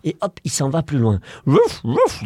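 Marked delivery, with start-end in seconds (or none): 1.5-2.2 high woofs; dog bark. Acted. Dog ignored by papa, barking. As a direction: yap it out. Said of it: "dit le chien".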